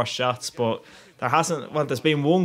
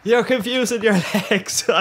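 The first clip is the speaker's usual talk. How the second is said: laughing babble; 9 semitones higher than usual. Retold or imitated. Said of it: "I was going".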